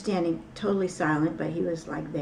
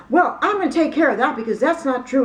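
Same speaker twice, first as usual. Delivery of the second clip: imitated angry yelling, high and loud; loud, heated insistence, pitch jumping up; David Miscavige; 8 semitones higher, 12 dB louder than usual. Church leader disputing what visitor was told, angrily yelling. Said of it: "really angry".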